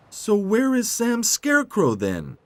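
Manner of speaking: high, loud cartoon question; puzzled tractor Tech. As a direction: ask in a high-pitched tractor voice. Tech puzzled about Sam.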